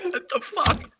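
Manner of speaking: squeaky disbelief; startled high what the fuck; shocked high squeak. Real, not acted. Reacting to weird face.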